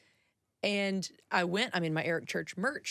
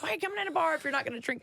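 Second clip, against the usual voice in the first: fast, much higher, incredulous mock voice; mocking bar regulars; 9 semitones higher, much faster than usual. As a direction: imitate the scoffing bar crowd, quick and high. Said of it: "people being like".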